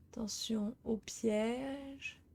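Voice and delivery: slow unvoiced whisper. Real, not acted. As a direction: breathe it out carefully. Sneaking past a wolf trap. (chuchoté lentement)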